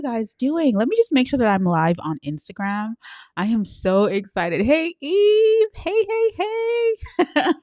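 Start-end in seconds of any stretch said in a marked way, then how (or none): 4.6-7.5 squealed greeting. Real, not acted. Greets a viewer in comments.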